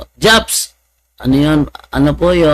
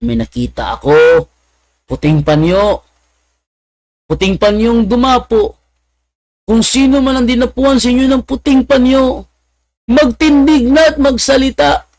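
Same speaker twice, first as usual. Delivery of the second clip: mock pastor prophecy; 9 semitones higher than usual. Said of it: "sabi ni Pastor Dinoro".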